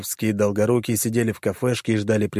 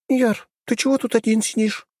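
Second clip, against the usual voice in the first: voiced higher as a young woman; 13 semitones higher than usual. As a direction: play her light and teasing. Girl Vera voiced.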